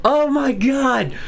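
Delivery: high, exasperated cry, slowing on Oh my God; real frustrated wail; exasperated outburst. Real, not acted. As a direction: throw your hands up in disbelief. Exasperated at viewer's reply.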